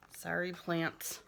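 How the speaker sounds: usual speaking voice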